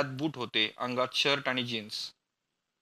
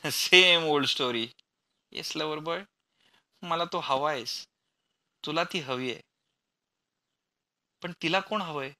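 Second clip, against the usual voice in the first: recited mockery. A narrator's reading. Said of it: "पाठ केलेले संवाद म्हटल्याप्रमाणे".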